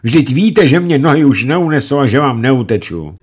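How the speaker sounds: high, loud desperate lament; mother screaming over her son. Acted. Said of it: "Setkin křičela".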